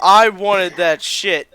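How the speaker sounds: put-on loud, slow shout of the kid; mock yell. Clerk mimics the kid's shout, loud and drawn out. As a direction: bellow it out slow and hard. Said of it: "And he's like".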